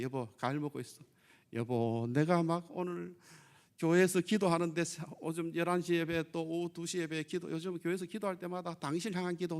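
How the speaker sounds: low, softened coaxing; acted cheerful wife. Wife greeting resting husband after church, acted tenderness. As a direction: play a wife sweetly indulging her husband. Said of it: "무조건 웃어야 돼".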